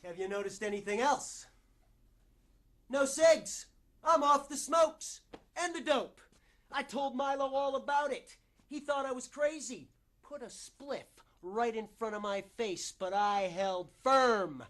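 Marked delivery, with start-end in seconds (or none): 2.9-9.9 high, loud, eager self-report; proud boasting. Acted. Proving he's off the smokes, excited boasting. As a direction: announce it with pitched-up, pushy pride.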